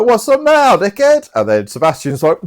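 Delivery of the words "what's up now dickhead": high, loud goading; hammed-up cocky jeer; mock taunt. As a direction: taunt him like a squeaky would-be brawler. Voicing Andrew taunting after slap.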